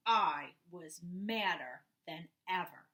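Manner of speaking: plain reading voice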